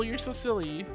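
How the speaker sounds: normal talking voice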